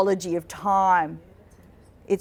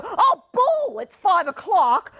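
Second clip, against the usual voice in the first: mock shock; 10 semitones higher, slower than usual. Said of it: "then they go".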